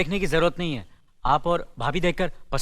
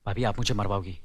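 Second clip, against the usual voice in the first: low, soft, embarrassed protest; bashful; 8 semitones lower, 6 dB quieter than usual. Acted. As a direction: protest shyly under your breath. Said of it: "Sharma रहा है". (शर्माते हुए दबी आवाज़ में)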